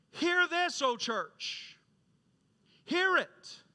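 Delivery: high, loud preacher's call; proclaiming Christ's words. Acted. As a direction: call it out to the whole church, high and forceful. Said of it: "Christ is saying".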